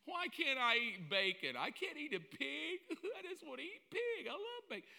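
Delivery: hammed-up whining believer; whiny complainer. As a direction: pout like a spoiled kid denied bacon.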